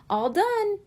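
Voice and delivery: put-on high, bright little-boy cheer; exclaiming. A narrator's reading. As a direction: call it out proudly, all done. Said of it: "he exclaimed".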